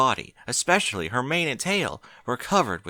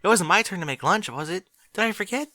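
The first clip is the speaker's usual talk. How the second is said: gasping worry; 10 semitones higher than usual. Acted. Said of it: "she gasped".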